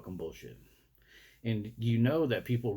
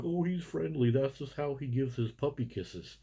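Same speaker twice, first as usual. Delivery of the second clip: higher-pitched cooing excuse, mocking; gushing dog owner; 4 semitones higher than usual. Dog owner excusing his dogs to a visitor, mock gushing. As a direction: coo it higher, imitating an indulgent dog owner. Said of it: "I'd sit there and be like".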